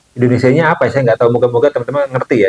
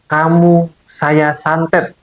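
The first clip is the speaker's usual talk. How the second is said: slow, high, deliberate mock threat; retold face-to-face threat; 6 semitones higher, much slower than usual. Relative curses the client to their face, retold slow threat. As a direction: draw out each word, raised, as a retold curse. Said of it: "di depan mukanya dia, dia bilang gitu".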